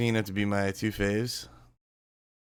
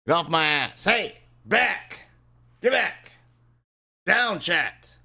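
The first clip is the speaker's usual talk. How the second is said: mock shout; 8 semitones higher, 6 dB louder, slower than usual. Shooing chat off playfully.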